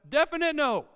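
high, loud emphatic verdict; firm refusal. Retold or imitated. Dad answering child's preemptive grumbling, loud firm refusal. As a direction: act out a dad laying down the law.

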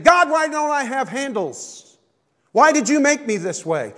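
complaining to God. Pretend. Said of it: "Instead of saying".

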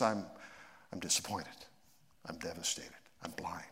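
quiet and low, fading to a whisper; a quiet, crushed retelling of the worst moment; devastated, near tears. Retold or imitated. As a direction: let the words sink down to a broken whisper. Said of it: "I began to cry, sobbing".

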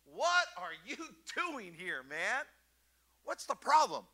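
slow, scolding God with a half laugh; what are you doing here, man, said with exasperation; accusing. Shown as a demonstration. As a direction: demand it like a disappointed boss. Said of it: "I used to read this scripture like this".

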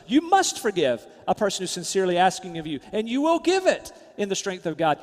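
ordinary talk, nothing marked

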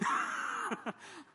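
sudden high burst of laughter. Real, not acted. Telling the congregation about the call, a high laugh. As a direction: laugh out at the absurdity.

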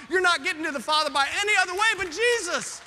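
ramp up into full preaching fire; preaching climax. Real, not acted. Sermon climax building.

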